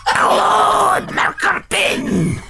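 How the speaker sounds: high, slow, hammed-up villain voice; mock-sinister cartoon villain greeting; bad French Disney villain. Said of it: "You sound like a Disney villain".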